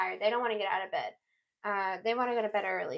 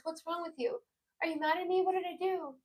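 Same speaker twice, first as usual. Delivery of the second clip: anxious questions; 6 semitones higher, 4 dB quieter, faster than usual. Pretend. Voicing the anxious guilty person.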